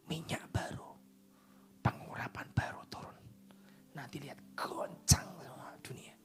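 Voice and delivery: hushed whispered prophecy; prophetic whisper. Real, not acted. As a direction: lean in and whisper the promise.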